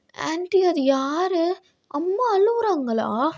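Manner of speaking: put-on high childlike voice asking innocently; little boy voice. Child voice in story.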